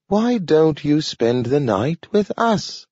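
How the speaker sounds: high, slow talking dog; a slow, careful talking dog. A narrator's reading. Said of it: "he invited".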